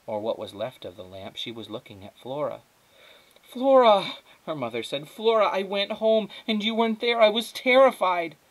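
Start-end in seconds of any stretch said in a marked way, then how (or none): 3.4-4.1 high, loud, long call of alarm; crying out her name. Acted. Mother spots her missing daughter, crying out. 5.1-8.4 high, loud, distraught outpouring; frantic mother. Acted. Mother to her found daughter, frantic and high.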